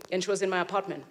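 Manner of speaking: ordinary delivery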